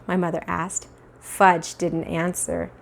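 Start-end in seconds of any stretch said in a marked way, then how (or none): none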